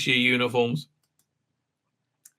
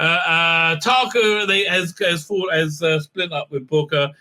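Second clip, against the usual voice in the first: ordinary talk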